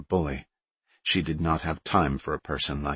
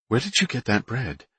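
envious; 6 semitones higher than usual. Acted. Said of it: "a tone in her voice that conveyed deep envy".

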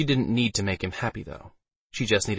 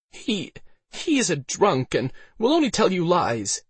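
high, halting, slower protest; Elsa's rattled voice, pitched up; nervous pleading; 10 semitones higher than usual. A narrator's reading. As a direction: stammer a high-voiced excuse. Frightened Elsa discredits the drunk.

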